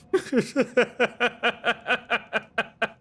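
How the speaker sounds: long, high run of staccato laughter; long giggling laugh. Real, not acted. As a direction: crack up in high, rapid ha-has. Amused by dad content idea.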